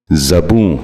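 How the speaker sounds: زبوں with short a first, "za-boon"; correct زبوں. Shown as a demonstration. Teacher modelling for viewers, زبوں said as "zaboon". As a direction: open زبوں with za, not zu. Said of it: "دوبارہ سنیے".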